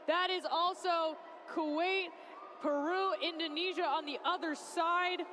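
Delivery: excited sports commentary; live play-by-play. Real, not acted. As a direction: call the action as it happens.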